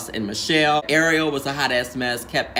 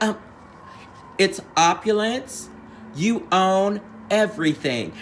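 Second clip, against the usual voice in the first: imitating Michelle's careful correction; judge's correction; 5 semitones higher than usual. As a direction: correct her patiently, a little higher. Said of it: "Michelle's like".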